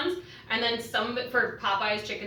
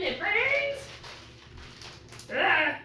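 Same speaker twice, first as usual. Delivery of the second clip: sudden high squeak from onion sting; burning eyes; 8 semitones higher, much slower than usual. Real, not acted. Chopping onion at home, high yelp. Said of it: "This onion is attacking me".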